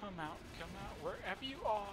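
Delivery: soft, lilting hide-and-seek call; playful taunt. Pretend. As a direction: coax them out in a quiet sing-song.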